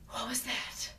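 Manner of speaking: softly imitated spooky question; hushed, creepy sleeper's question; eerie, creepy voice. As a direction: ask it quietly and eerily. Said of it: "In the most airy voice, creepy voice".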